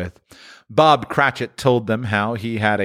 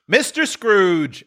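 higher, slow raised toast; Bob's toast; 11 semitones higher, much slower than usual. A narrator's reading. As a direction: honour the absent employer. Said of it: "said Bob".

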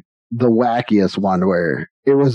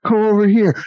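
retold yell; 9 semitones higher than usual. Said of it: "Dave starts yelling at the guy".

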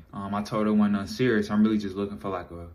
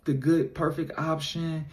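higher, soft guilty confession in her voice; imitating a sorry girlfriend, higher and hushed; apologetic girlfriend; 6 semitones higher, 3 dB quieter than usual. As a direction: play the remorseful girlfriend, slowing at the end. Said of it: "she like".

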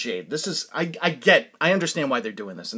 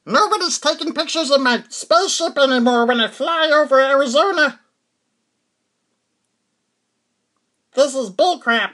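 a grumpy space visitor griping; alien voice; 11 semitones higher, 8 dB louder than usual. Acted. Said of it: "It's my alien impression".

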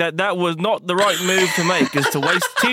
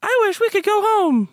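character voice; 11 semitones higher than usual. Acted. High character voice.

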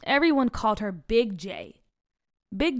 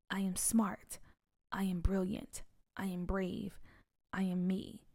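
quiet self-talk; 3 semitones lower, 10 dB quieter than usual. Acted. Reciting mom's confidence mantra.

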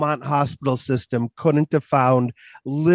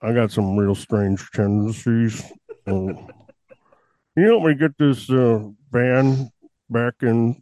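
Buffalo Bill voice; 4 semitones lower than usual. Acted. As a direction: play a strange doctor as a movie creep. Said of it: "Are you gonna talk like that".

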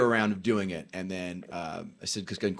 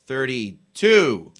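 usual speaking voice

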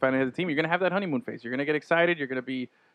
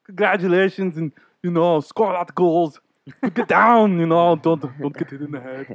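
loud, hammy congratulation message; mock celebrity voice; 4 semitones higher, 6 dB louder than usual. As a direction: overplay a celebrity video greeting. Mock celebrity advice clip.

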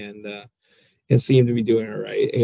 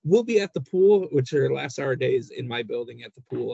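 ordinary talk, nothing marked

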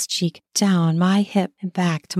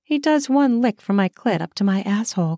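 usual speaking voice

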